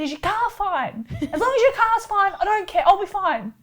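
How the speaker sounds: high, loud mock concern; sarcastic. Mocking the drivers who blamed her, high and sarcastic. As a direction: lay the fake concern on thick, laughing.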